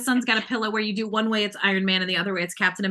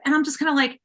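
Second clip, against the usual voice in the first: ordinary talk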